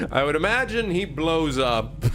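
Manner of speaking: laughing. Real, not acted. Laughing at chat joke.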